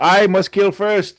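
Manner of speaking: high, slow put-on zealot voice; playful impression of a zealot; mock fanatic vow. Acting out a radicalised villager's vow.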